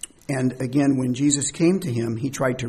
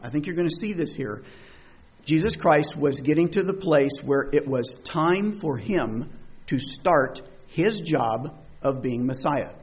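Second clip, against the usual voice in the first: usual speaking voice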